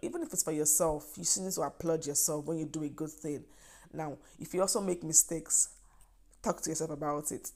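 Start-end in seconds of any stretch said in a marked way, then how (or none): none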